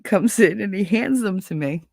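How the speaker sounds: usual speaking voice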